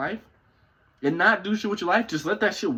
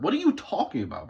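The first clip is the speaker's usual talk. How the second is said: ordinary delivery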